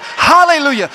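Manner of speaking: loud, high preacher's hallelujah; shouted praise to the church; lifted-voice hallelujah. Real, not acted. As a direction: lift your voice and shout it. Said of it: "you can still lift your voice and say".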